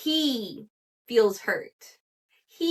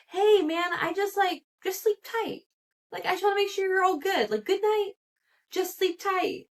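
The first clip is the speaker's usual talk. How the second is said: high-pitched, sheepish mock friend; 9 semitones higher than usual. Guy wishing his friend goodnight, sheepish high voice. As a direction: imitate a friend tenderly explaining himself, voice lifted high.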